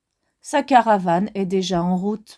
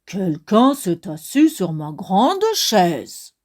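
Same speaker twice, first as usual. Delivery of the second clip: low, slow papa bear voice; low, slow big bear; 5 semitones lower, slower than usual. Acted. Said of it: "s'écrie de sa grosse voix".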